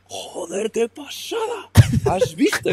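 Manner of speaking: squealed amazement at the gorilla charge; mock thrilled shout. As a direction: ape their wild excitement.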